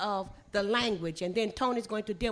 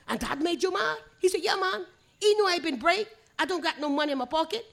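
fast, higher Gullah read-through; 5 semitones higher, faster than usual. Shown as a demonstration. Storyteller reading the whole tale through for visitors, quick Gullah. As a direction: rattle the dialogue off in Gullah. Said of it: "read it all the way through".

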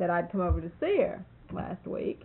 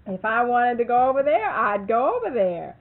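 high, loud, building to a laughing shout; laughing retort; 4 semitones higher, 11 dB louder than usual. Retold or imitated. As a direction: deliver the punchline, cracking up at the end. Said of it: "I says to him".

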